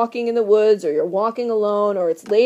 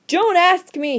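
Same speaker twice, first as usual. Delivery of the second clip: higher, louder panicked voice; frantic plea; 7 semitones higher, 4 dB louder than usual. Acted. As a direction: beg him not to ask, frightened. Frantic Victor.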